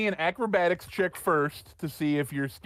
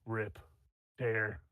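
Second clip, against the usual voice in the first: low feral growl; 5 semitones lower, 6 dB quieter, slower than usual. Acted. GM voices Ruckus's feral thought.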